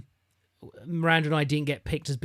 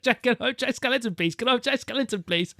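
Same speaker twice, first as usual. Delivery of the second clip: put-on eager child asking; 8 semitones higher than usual. Child asking Santa again.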